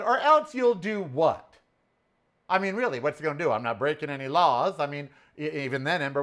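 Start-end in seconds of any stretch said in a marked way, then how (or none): 0.0-1.5 slow, high and louder dare. Retold or imitated. To the security guard, a slow daring challenge. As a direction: draw out the dare, daring him. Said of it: "I said".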